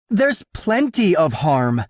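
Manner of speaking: high, loud indignant woman's voice; indignant heroine, pitched high; flustered woman. A narrator's reading.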